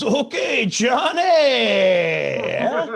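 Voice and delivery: high, loud teasing holler with a stretched ending; mock hype yell. Barista teases customer over his name, mock excited shout.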